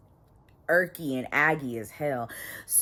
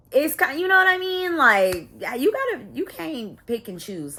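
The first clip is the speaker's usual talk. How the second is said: exasperated; 8 semitones higher, 6 dB louder than usual. Real, not acted. Exasperated about Rob's mom.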